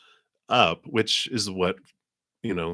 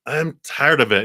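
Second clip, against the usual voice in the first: louder, higher mock exasperation; acted fed-up outburst; 7 semitones higher, 8 dB louder than usual. Person handed too many cups of emotions, fed up. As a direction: burst out as if you've had enough.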